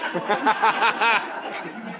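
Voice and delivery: a big real burst of laughter; loud laugh. Real, not acted. Laughing mid-sentence.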